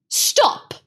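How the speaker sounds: high breathy snapped stop; witch's sharp order. Acted. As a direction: cut them off like a queen. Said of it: "said the witch".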